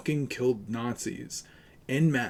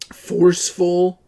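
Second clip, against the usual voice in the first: forceful punched high, loud and slow; stress on forceful; 7 semitones higher, 11 dB louder, slower than usual. Real, not acted. Streamer arguing with chat, stressing forceful. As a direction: lean hard on forceful, drawn out. Hammering home a debate point.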